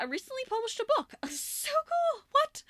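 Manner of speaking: high excited squeal. Real, not acted. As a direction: burst out with excitement. Excited book announcement.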